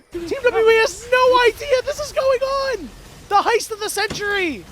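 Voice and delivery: high, loud, ecstatic play-by-play; hype call. Real, not acted. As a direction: sell the heist to the whole crowd. Hype call on base steal.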